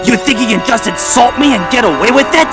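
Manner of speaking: high, indignant fury; raging. A narrator's reading. Cranky to the dangling Diesel, raging. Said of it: "he raged".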